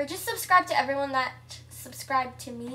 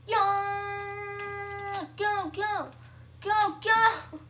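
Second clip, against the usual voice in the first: high, loud cheer; high-pitched excited yell; excited shouting; 7 semitones higher, 5 dB louder, much slower than usual. Real, not acted. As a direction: holler them on, drawing out the first shout. Cheering as the round starts.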